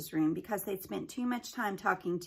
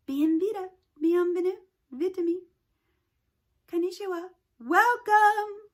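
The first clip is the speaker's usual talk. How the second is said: high, loud booming welcome; booming announcer; 10 semitones higher, 6 dB louder than usual. A narrator's reading. Library speaker booms a welcome to Haley, loud and high. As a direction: boom it out like a ceiling loudspeaker. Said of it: "boomed a voice from the ceiling speakers".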